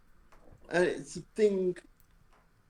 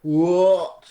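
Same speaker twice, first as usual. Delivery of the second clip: surprised; 2 semitones lower, 7 dB louder than usual. Retold or imitated. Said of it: "immediately I'm like".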